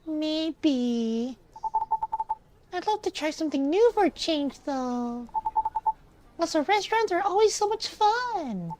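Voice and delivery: put-on high-pitched, keen nine-year-old; high child voice full of wishing; kid voice. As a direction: gush like a little kid. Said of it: "I'm reading in this kid voice".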